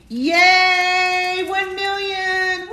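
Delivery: high, loud, slow cheer; excited cheer. Real, not acted. Celebrating a million likes.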